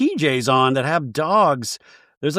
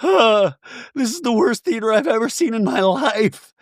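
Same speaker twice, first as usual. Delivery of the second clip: mock crying; 7 semitones higher than usual. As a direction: fake sobbing over bad theater. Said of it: "you hear just people crying".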